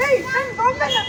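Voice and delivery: sudden high, loud cry of "ಏಯ್"; angry outburst. Shown as a demonstration. Director demonstrates the girl rising in anger to shout. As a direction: leap up furious and yell for the doll. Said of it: "ಅವಳು ಕೋಪದಲ್ಲಿ ಎದ್ದೇಳು".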